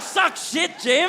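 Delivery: very high mock taunt; jeering shout. [name] joining in the joke about his friend Jim, jeering. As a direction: imitate a gleeful, high-pitched heckle.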